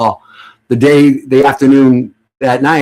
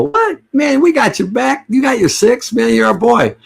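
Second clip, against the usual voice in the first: mock buddies' protest; 11 semitones higher than usual. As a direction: play the friends, outraged and much higher. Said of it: "And they go".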